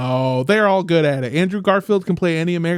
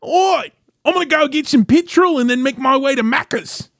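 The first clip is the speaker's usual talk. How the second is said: higher, hammed-up Aussie voice with a strong Oi; playful Australian accent, leaning into petrol and Macca's; mock Australian accent; 7 semitones higher than usual. Mocking how Australians talk to friends, hammed-up Australian accent. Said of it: "you gotta be like".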